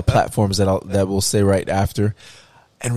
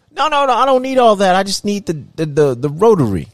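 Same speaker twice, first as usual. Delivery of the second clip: grandmother; 11 semitones higher, 6 dB louder than usual. Retold or imitated. Grandmother voiced refusing touchscreen.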